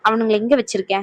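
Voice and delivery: ordinary delivery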